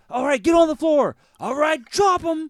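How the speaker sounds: high, loud barked police commands; cop shouting orders. Retold or imitated. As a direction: yell it like a SWAT officer storming in. Cops shouting orders in movie.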